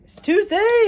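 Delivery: excited announcement. Real, not acted. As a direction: announce the day like it's big news. Realizing the day, announcing it.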